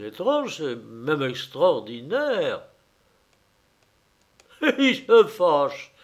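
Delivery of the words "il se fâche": slow, higher, laughing through it; mock him while laughing. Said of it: "dit l'homme en riant". (en riant, moqueur)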